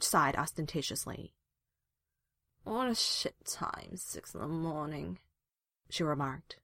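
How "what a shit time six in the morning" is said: slow, soft, slurred sleepy grumble; mush-mouthed with sleep. A narrator's reading. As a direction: mumble it half awake, dragging the words. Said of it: "She was mush-mouthed with sleep".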